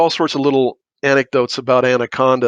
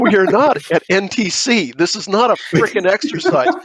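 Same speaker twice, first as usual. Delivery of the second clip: higher-pitched, mildly worked-up protest; retold outburst, pitched up but not shouted; indignant outburst; 6 semitones higher than usual. Contractor objecting from the peanut gallery at a briefing, indignant. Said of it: "inside voice".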